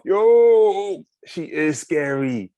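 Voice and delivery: loud call, then slow; shouting. Acted. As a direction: call it out through cupped hands. Dan calls out through fist.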